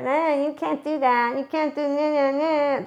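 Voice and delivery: mocking impression of a nagging naysayer; naysaying killjoy. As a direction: drag out the nah like a scolding spoilsport. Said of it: "That's what they sound like".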